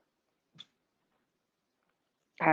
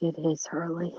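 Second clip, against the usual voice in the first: whispered agreement; 6 dB quieter than usual. Retold or imitated. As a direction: breathe it out as a whisper. Agreeing quietly in retold reply.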